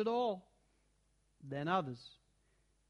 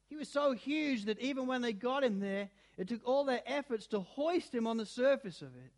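ordinary delivery

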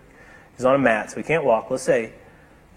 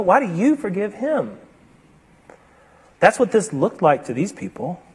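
ordinary talk, nothing marked

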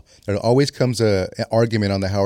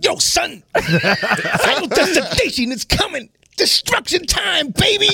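much higher, shouted hype-man rasp; [name] voice; slower than usual. Retold or imitated. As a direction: yell it like a frantic doom prophet. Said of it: "Nick V would do a [name] impersonation".

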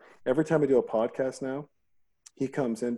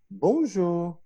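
mock French greeting; 6 semitones higher than usual. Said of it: "I only speak French to him".

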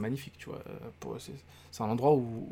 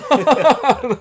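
big high laugh; loud burst of laughter; 10 semitones higher, 13 dB louder than usual. Real, not acted. Denying anything sexual with a loud laugh.

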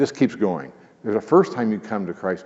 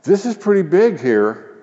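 mock crowd; 9 semitones higher, 6 dB louder than usual. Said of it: "they're kinda like".

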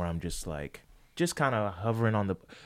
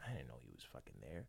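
rushed, much softer inner thought; hushed fast aside; 10 semitones lower, 25 dB quieter, much faster than usual. Retold or imitated. The extra spots the director and mutters to himself quickly and softly. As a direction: hiss it to yourself in quick surprise. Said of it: "I'm just like".

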